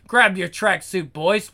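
ordinary talk, nothing marked

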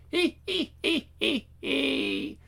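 high, soft eerie giggling; creepy giggle. Acted. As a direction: giggle like a creepy little creature. Creature giggling.

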